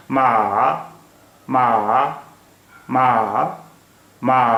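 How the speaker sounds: long मा dipping down, then rising; third tone. Shown as a demonstration. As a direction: draw मा out, dropping then lifting the pitch. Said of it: "नीचे से फिर ऊपर जा रही है".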